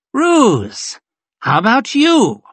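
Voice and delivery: single him out with a loud challenge; showy officer's public summons; calling out. A narrator's reading. Colonel singles out a man publicly.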